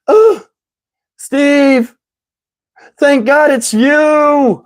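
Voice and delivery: high, drawn-out damsel impression; mock-cry with relief, stretching the words; imitating tearful woman. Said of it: "I'm gonna do her voice exactly how she said".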